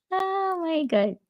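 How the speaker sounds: high, slow amused oh my God; amused exclamation. Real, not acted. Laughing at her own joke about adulting, high.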